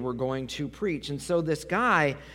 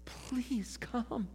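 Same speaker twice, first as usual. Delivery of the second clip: high, hushed plea; soft begging; 8 semitones higher, 8 dB quieter than usual. Acted. Desperate father pleading with Jesus, soft begging. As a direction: beg gently in a small, raised voice. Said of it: "He's begging him".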